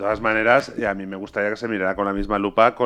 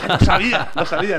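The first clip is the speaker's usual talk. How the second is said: high, laughing triumph; a fan crowing that he knew it; triumphant; 8 semitones higher, 4 dB louder than usual. Retold or imitated. Fan triumphant at guessing right.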